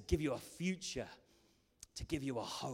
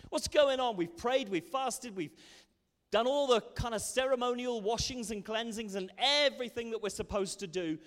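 higher, louder protest; indignant complaint; 6 semitones higher, 6 dB louder than usual. Acted. People of God complain their prayers go unanswered, indignant. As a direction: plead your case in exasperation.

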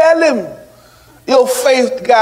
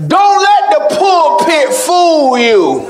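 raised, ringing sermon delivery; full-force preaching; 7 semitones higher than usual. Real, not acted. Preacher tells the church how hard forgiving is, full-force preaching. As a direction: preach it high and forceful to the congregation.

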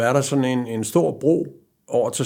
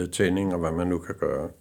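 ordinary delivery

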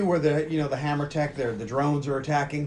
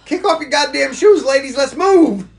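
high, loud drill-coach holler; exasperated mock shout; 10 semitones higher, 12 dB louder than usual. Urging movie heroines running in heels, exasperated shouted order. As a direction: yell it like hurrying stragglers along.

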